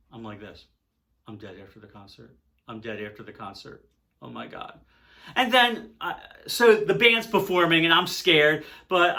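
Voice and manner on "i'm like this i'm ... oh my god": very quiet, low, numb repetition; stunned dread. Retold or imitated. Threatened fan amid a wild crowd, stunned murmur. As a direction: say it tiny and low, frozen with dread.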